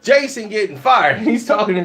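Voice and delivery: normal talking voice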